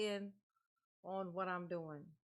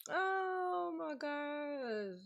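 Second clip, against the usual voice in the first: slow oh my gosh; 6 semitones higher, much slower than usual. Real, not acted. Na het lachen.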